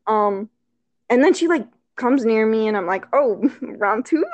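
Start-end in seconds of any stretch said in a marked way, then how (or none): 3.1-4.2 slow, teasing question with a laugh; coyly hint at another kiss; playful, drawn-out suggestion; flirty. Retold or imitated. Acts out hopeful flirt as date nears.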